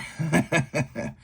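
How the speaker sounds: high chuckle at own mistake; self-deprecating laugh. Real, not acted. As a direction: laugh it off after admitting a silly slip.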